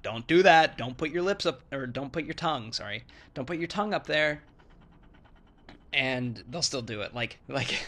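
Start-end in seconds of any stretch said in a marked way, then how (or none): 0.0-4.4 louder, higher correction; mock-insistent telling a friend off; correcting a friend. Telling a friend how to place the tongue, insistent. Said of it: "I'm like".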